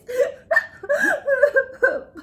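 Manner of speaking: loud, high sobbing wail; wailing cry. Acted. Sad crying in the cave, loud wail. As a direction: let out a big wail. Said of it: "it wasn't a laugh".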